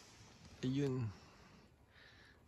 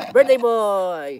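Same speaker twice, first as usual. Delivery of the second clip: loud cheer; 5 semitones higher, 10 dB louder, much slower than usual. Real, not acted. Dad cheering his son.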